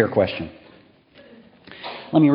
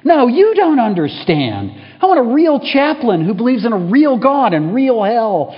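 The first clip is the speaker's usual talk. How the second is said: much higher, loud angry retort; 11 semitones higher, 8 dB louder than usual. Acted. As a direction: throw the words back at her. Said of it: "the chaplain counters".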